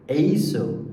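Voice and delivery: ordinary talk, nothing marked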